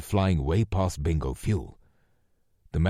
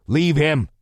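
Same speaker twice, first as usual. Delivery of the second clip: loud, higher shouted order; yelled; 9 semitones higher, 8 dB louder than usual. A narrator's reading. Lou yelling to the sergeant while running. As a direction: bark it over the shoulder. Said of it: "Lou yelled".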